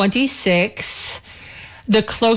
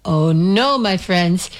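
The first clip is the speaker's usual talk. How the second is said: ordinary talk, nothing marked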